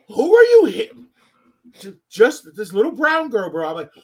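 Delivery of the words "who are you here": high, loud call across the room. Retold or imitated. Asking the little girl who she's with, loud high call. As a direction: call out to find who she belongs to. Calling out to a lost child.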